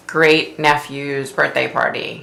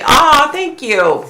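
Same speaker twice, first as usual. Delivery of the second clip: touched thank you; 11 semitones higher, 5 dB louder, much slower than usual. Real, not acted. Thanked for a hair compliment.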